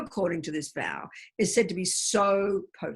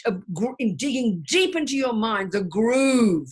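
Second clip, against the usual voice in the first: normal talking voice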